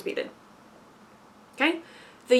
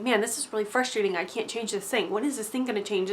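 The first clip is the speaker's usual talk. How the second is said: softer, deflated self-talk of frustration; mock frustrated muttering; 8 dB quieter than usual. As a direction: sigh it out as if stuck and fed up.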